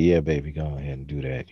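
low, seductive phone-sex tone; sexy nighttime voice. Retold or imitated. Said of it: "did your voice just go deeper when you said that?".

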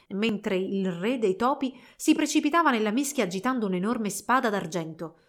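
usual speaking voice

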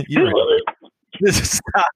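cracking up mid-words, high and helpless; laughing too hard to speak. Real, not acted. Laughing at partner's sneeze.

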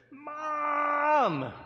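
long, very high bellow for a parent; hugely drawn-out, high-pitched child's holler; exasperated shout. Acted. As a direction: yell it out like a fed-up child. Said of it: "exasperated, the little boy".

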